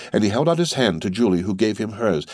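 ordinary delivery

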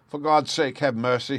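high-pitched frantic plea; desperate, panicked begging; terrified shriek. A narrator's reading. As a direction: shriek for mercy. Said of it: "he shrieked".

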